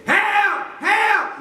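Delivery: high, loud cry for help; mama crying for help. Acted. Mama screaming from the car window. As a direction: shout it like a panicked mother. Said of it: "you know what she said".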